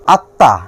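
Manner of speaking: আত্মা said atta, with ম silent and a doubled ত. Shown as a demonstration. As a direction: hold the ত twice in আত্মা, no ম. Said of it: "তয়ের উচ্চারণ হবে দুইবার". (ত দ্বিত্ব করে আত্তা উচ্চারণ)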